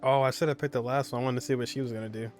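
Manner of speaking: usual speaking voice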